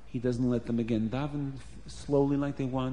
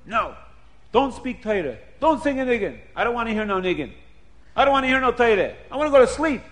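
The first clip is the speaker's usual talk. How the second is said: high, loud ranting host; building high-pitched tirade; angry shouted refusal; 7 semitones higher, 6 dB louder, faster than usual. Acted. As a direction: shout them down, louder as you go. Host forbids guests to speak.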